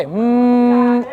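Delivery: long, low humming from nose and throat; vibrating hum. Shown as a demonstration. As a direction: hold a steady low "hmm" that buzzes in nose and throat. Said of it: "vibration बनाना नाक से और गले से".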